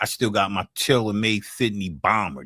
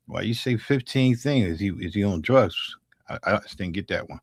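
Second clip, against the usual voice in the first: usual speaking voice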